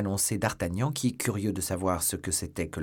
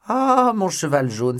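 loud, high, drawn-out cry with some joy; cry of surprise; 10 semitones higher, 10 dB louder, slower than usual. A narrator's reading. D'Artagnan spots his old yellow horse, astonished cry. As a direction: burst out, stretching the first word high. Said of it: "un cri de surprise qui n'était pas exempt d'un mélange de joie".

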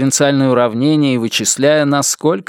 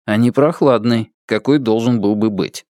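usual speaking voice

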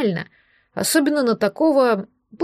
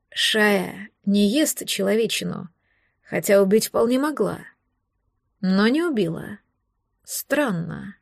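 musing slowly, with pauses between phrases; thoughtful drawl; 2 semitones lower, slower than usual. A narrator's reading. Man puzzling over the puma's mercy, thoughtful drawl. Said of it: "Протянул он задумчиво".

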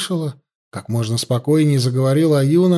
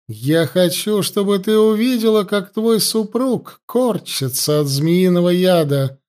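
higher-pitched, menacing priestess taunting her captive; gloating high priestess; 4 semitones higher than usual. Acted. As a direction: savour every threat like a cruel priestess. Priestess threatens Ayuna.

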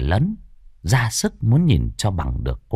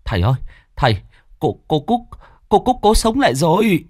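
out of breath; 9 semitones higher than usual. Acted. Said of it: "thở dốc".

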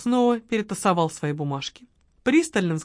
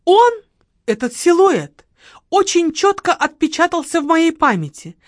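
overacted eyewitness; dramatic widow; 9 semitones higher, 7 dB louder than usual. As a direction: paint the silhouette dramatically. Margarita describing the killer.

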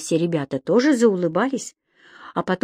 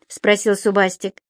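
ordinary delivery

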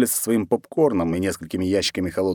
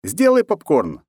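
calling out loud across the yard; shouting; 8 semitones higher, 4 dB louder than usual. Acted. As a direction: shout the order to the cook. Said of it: "крикнул я нашей главной кухарке".

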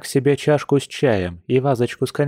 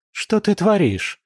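high, drawn-out outraged exclamation; exclaiming; 9 semitones higher than usual. A narrator's reading. Woman protests as the man lifts her dress, exclaiming. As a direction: cry out in protest, pitch jumping up. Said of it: "воскликнула она".

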